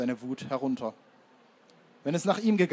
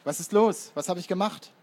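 erschrockene Abwehr; 5 semitones higher, 5 dB louder than usual. A narrator's reading. Erzähler wird bedrängt, wehrt ab.

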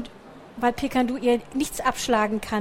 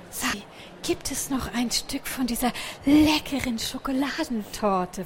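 breathless unvoiced whisper; 4 dB quieter than usual. A narrator's reading. The little mouse whispering her wish to Picandu. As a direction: whisper the request secretly.

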